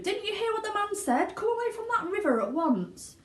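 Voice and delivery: high scolding mother voice. A narrator's reading. Mother scolding son at the river, high and cross. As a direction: order him back like a cross mother. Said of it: "called out Missus Gloop".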